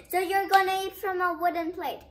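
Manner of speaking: furious son laying down the rule; angry. A narrator's reading. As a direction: snap out the punishment loudly and quickly. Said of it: "so, so mad".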